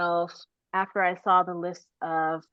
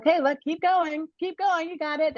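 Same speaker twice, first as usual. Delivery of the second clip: upbeat coach urging her onward; encouraging coach; 10 semitones higher than usual. Retold or imitated. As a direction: spur on a struggling runner, pitched up. Runners cheering her on from behind.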